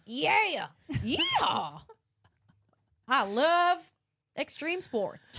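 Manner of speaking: loud, high mock American fan; American accent. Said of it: "Your American accent's so good".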